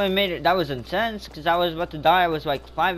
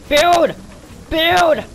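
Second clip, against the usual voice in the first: much higher, louder barked commands; frantic yelling; 10 semitones higher, 5 dB louder, much slower than usual. Real, not acted. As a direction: shout orders at yourself in a panic.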